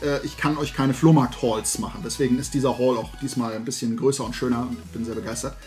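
ordinary delivery

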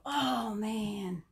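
drawn-out, soft group groan; exasperated groan. Acted. The other animals hear Bird wants drink, groaning. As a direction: groan it as fed-up friends.

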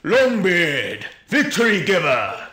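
high, ringing ritual call; shouted invocation. Acted. Master before the cauldron invoking Woden, shouting. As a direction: call out to a god before the hall. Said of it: "shouted the master".

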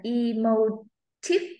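"emotive" very slow, jumping up on TIVE; third-syllable stress. Shown as a demonstration. Teacher offering stress options to a student, slow TIVE-stressed "emotive". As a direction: draw out e-mo, then lift TIVE. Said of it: "stress vào âm mấy".

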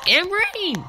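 high cry of delight; excited shout. Real, not acted. Gamer cheering the rain, high excited cry. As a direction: call out the rain gleefully.

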